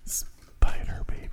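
slow voiceless whisper. Acted. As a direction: breathe it back slowly. Echoes the whisper.

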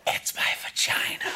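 breathy punchline whisper. Acted. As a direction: whisper it like a secret.

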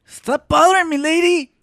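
loud, high, fed up; annoyed high shout. Acted. Annoyed outburst.

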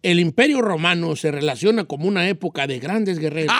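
low, even neutral Spanish reading; neutral accent. Shown as a demonstration. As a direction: neutral accent at a flowing pace, no singsong. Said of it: "Ahora más velocidad".

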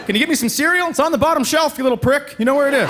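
high, mock-annoyed dad; sleepy dad hissing at his kid; irritated dad.

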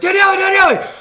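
very high, loud mock-kid yelling; hammed-up childlike excitement at full volume; over-excited kid interrupting. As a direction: burst in shrieking like a frantic child. Acts out bursting in like a child.

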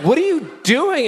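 high-pitched, exasperated question; indignant mock protest. Embarrassed driver to man following him, indignant protest. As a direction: squawk it at an unwanted onlooker.

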